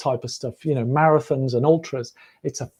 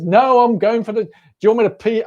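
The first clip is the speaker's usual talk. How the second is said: put-on raised, hurried American-accented retort; higher-pitched mock American protest; 6 semitones higher, 3 dB louder than usual. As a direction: imitate an indignant American runner. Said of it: "very strong American accent".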